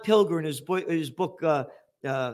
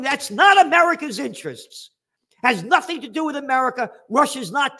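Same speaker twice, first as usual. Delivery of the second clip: higher and louder, spitting contempt; furious rant; 8 semitones higher, 4 dB louder than usual. Real, not acted. Host raging at the senator's words, shouting. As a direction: rail against him at full force.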